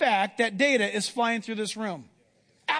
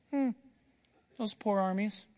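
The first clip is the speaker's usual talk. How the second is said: softly unconcerned, mild mock pity; unruffled Elijah; 2 semitones lower, 6 dB quieter than usual. Elijah looking at the enemy armies, simply unruffled. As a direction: toss it off softly, untroubled. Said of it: "Elijah just simply says".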